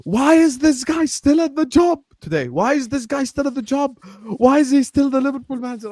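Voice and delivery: high-pitched mock exasperation; imitated rant. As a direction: act out dad's indignant ranting. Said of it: "he was ranting at me".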